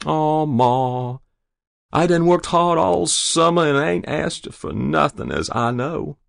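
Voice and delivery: put-on high boyish coaxing; wheedling plea. A narrator's reading. Boy with arm round Ma, wheedling. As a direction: whine about being owed it.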